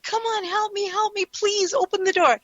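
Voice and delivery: high, pleading cry for help, mildly acted; imitating a scared girl. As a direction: retell the girl begging through the door. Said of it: "and she's like".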